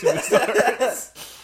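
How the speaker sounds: high squeal of laughter breaking through the words; can't get it out for laughing. Real, not acted. Telling a friend about a camp kid, laughing hard.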